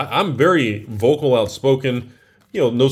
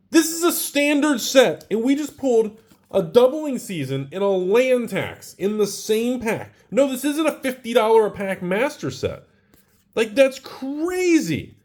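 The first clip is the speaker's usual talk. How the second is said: much higher, amazed and thrilled; can't believe the pull, gushing; excited; 10 semitones higher than usual. Real, not acted. Double rare pull, disbelief.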